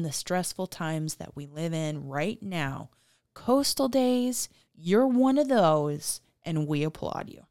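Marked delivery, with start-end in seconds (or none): 3.3-6.2 calling out. Real, not acted. Calling out a brewery.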